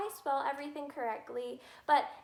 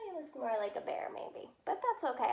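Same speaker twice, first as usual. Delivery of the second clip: normal talking voice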